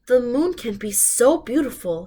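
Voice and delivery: usual speaking voice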